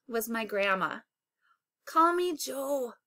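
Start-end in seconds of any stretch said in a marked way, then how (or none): none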